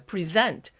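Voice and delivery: "present" as a verb, pre-ZENT; stress on second syllable. Shown as a demonstration. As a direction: put the weight on the last syllable of "present". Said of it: "뒤에 강세가 오느냐". ("present"의 뒤 음절에 강세)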